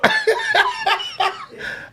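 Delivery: a sudden, very high laugh; high burst of laughter. Real, not acted. A man laughs at his own story, high burst of laughter.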